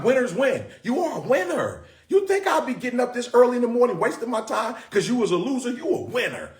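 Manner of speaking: real excited, punchy exhortation; pumped-up excitement. Real, not acted. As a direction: fire up the crowd like a coach. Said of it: "I'm getting so excited".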